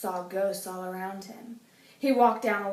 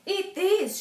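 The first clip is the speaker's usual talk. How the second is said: Francine's voice; 10 semitones higher, 3 dB louder than usual. A narrator's reading. Francine offering spooky snacks.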